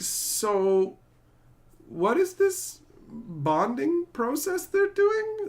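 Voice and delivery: drawn out in utter confusion; baffled question. Retold or imitated. Watching the movie and asking, slowly baffled. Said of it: "And I'm just like".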